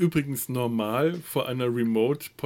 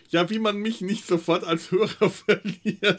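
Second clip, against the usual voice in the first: laughing quote; 7 semitones higher than usual. Retold or imitated. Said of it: "Und ich dachte".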